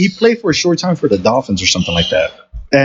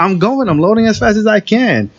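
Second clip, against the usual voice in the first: retold protest; 9 semitones higher than usual. Retorting to yelling boss.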